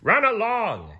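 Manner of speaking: high booming king voice; kingly command. A narrator's reading. King dismissing the little sponge, high and loud. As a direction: shoo him off like a king. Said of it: "he said".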